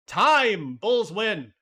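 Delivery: loud, high, triumphant call of the win; game over shout; excited shout. Real, not acted. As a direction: belt it out like the buzzer just went. Calling the game result.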